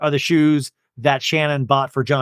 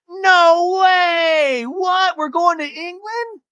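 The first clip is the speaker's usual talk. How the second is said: mock amazed shriek; much slower than usual. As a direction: imitate the group shrieking at the news. Everybody reacts to trip reveal.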